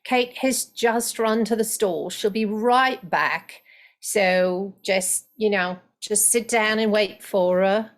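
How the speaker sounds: relaxed London Estuary accent; Estuary English. Shown as a demonstration. Coach demos Estuary grammar, London Estuary accent. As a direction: tell a friend to sit and wait, in Estuary English. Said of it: "if I'm doing estuary".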